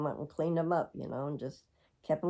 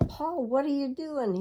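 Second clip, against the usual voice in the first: a much higher, startled question; walking in on a husband with his bed raised high, asking it much higher in surprise; surprised; 10 semitones higher than usual. Retold or imitated. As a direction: catch him at it, amazed.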